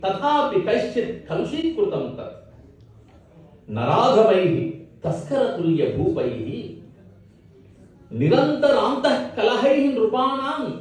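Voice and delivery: lilting, measured chant of verse; Sanskrit verse recitation. Acted. Poet praising Bharat to a gathering, chanted recitation. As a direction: recite with drawn-out line endings.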